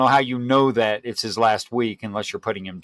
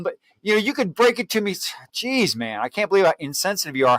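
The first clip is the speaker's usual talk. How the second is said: ordinary delivery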